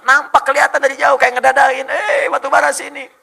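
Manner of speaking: high, loud far-carrying call. Acted. Coal beckoning from afar, high loud calling voice. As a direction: wave someone over with your voice.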